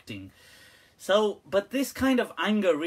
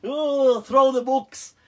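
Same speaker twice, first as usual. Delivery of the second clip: building to a hammed-up loud outburst; hammed-up tantrum rising to a yell; mock tantrum; 5 semitones higher, 4 dB louder, much slower than usual. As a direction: stretch then shout like a sulking child. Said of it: "They're like".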